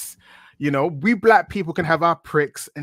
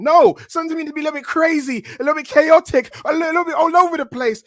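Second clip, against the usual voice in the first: high, loud, fast outburst; animated ranting; 10 semitones higher, 6 dB louder than usual. Real, not acted. Guest argues to host for wilder promos, animated ranting. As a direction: burst out arguing for chaos.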